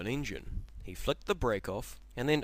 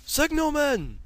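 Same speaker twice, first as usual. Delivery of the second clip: very high, loud call; shouted call; 10 dB louder, slower than usual. Acted. Henry calling the signalman for help, shouted call. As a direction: call across the yard. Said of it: "he shouted".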